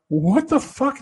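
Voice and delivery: high surprised exclamation; startled. Retold or imitated. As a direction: react in high-pitched surprise. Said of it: "and I go".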